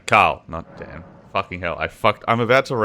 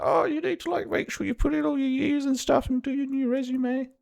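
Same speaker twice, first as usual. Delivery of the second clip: mocking quote; 4 dB quieter than usual. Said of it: "suddenly it was like".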